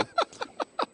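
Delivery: high, soft wheezy laugh; real amused laughter. Real, not acted. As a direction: laugh helplessly under the talk. Co-host joke about doughnut stop.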